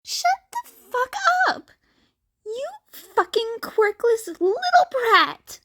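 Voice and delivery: put-on shrieking drunk mother; screaming mother. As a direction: scream down at the child. Mother screaming after slap.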